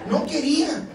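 ordinary talk

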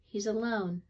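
usual speaking voice

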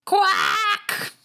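harsh, high, shouted quack; duck quack. Acted. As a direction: squawk like a frenzied duck. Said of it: "Do you like my impression of a duck?".

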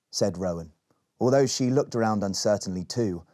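plain reading voice

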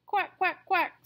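high, loud little quacks; duckling quacks. Acted. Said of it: "say the ducklings".

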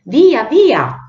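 high, loud urgent cry; shouting. A narrator's reading. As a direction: shout it like a howling wind arriving. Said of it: "arrivò urlando". (urlato, acuto e forte)

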